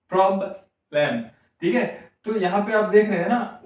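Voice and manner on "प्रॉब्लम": प्रॉब्लम slowly in two beats, prob, lem; प्रॉब्लम cut clearly in two; syllable by syllable. Shown as a demonstration. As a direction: pause between the syllables of प्रॉब्लम. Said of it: "प्रॉब्लम को आप pronounce करिए".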